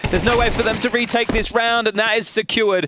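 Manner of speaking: urgent casting call; play-by-play. Acted. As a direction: call the round fast. Live caster call.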